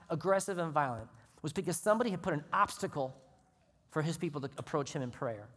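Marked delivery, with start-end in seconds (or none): none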